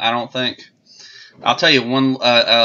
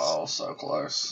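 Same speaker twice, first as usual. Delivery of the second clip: whispered; 8 semitones lower, 13 dB quieter, much slower than usual. Real, not acted. Muttering while trying something on camera.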